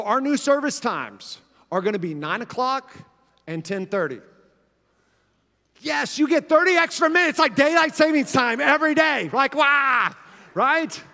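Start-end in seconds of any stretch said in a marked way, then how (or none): 5.8-11.0 higher and louder, thrilled; excited. Real, not acted. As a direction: cheer it like great news. Excited about extra thirty minutes.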